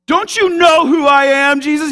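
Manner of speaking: high, loud acted challenge; mock self-important indignation. The created pulling rank on the creator, mock indignant. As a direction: puff it up into an incredulous demand.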